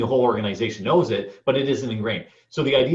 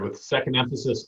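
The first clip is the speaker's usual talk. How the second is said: ordinary talk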